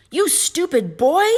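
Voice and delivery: high, loud, drawn-out scolding; furious roar. A narrator's reading. Mother furious at Jack over the bean, high angry roar. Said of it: "she roared".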